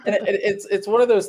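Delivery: laughing through the words. Real, not acted. Guest laughing as the host presses for names. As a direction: laugh it off while starting to answer.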